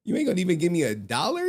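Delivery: quick, high retold protest; incredulous plea. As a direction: hurry out a disbelieving question. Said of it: "I was like".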